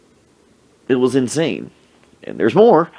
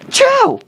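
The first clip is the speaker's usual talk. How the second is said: loud, high-pitched sneeze burst; mock sneeze; 6 dB louder than usual. Friend sneezing on the food tray, loud mock sneeze. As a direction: let out an explosive fake sneeze.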